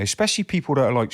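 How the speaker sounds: ordinary talk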